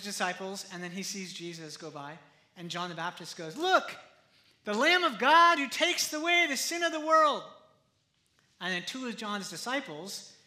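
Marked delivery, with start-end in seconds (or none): 3.6-7.4 high, loud proclamation; raised-voice cry of discovery; calling out. Acted. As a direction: announce it loudly to everyone within earshot. Acts John calling out to disciples.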